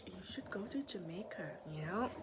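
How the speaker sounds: soft unvoiced whisper, coaxing; whispered urging. Patient urging the nurse to vacation, whispered. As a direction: whisper it like a secret tip. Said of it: "I was like".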